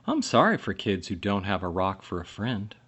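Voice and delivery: ordinary delivery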